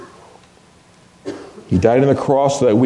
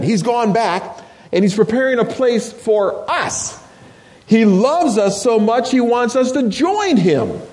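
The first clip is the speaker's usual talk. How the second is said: higher, uplifting preacher's voice; joyful preaching; 10 semitones higher than usual. Acted. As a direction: lift them up with good news.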